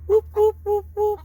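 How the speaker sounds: high playful whoop. Pretend. Hen flaps, mock cry.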